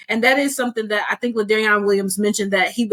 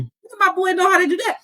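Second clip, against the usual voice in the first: proud; 8 semitones higher, faster than usual. Retold or imitated. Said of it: "I'm like".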